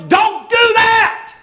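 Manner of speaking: very high, loud, slow warning yell; holler. Retold or imitated. Bystander hollering at the wrestler to stop, alarmed. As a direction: yell to stop him in time. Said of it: "somebody hollers".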